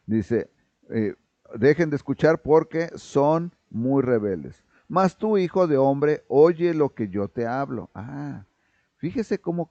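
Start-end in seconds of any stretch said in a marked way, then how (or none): 1.6-4.6 stern, dry, commanding Lord, a bit louder and slower; the Lord's stern commanding voice toward the rebels; strict voice of the Lord. Acted. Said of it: "al Señor hablando con una voz estricta". 4.9-7.9 loud, strict Lord's command, still at full force; God's strict voice, before the change; stern commanding Lord. Acted. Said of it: "cambia su tono cuando habla con Ezequiel".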